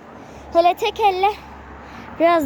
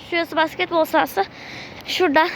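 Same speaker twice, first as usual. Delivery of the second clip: ordinary talk, nothing marked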